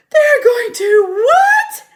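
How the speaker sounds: high, loud, drawn-out squeal of terror; terrified scream. Acted. Pig screaming at the sheep in horror. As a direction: shriek it out as the news sinks in. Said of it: "screamed Wilbur".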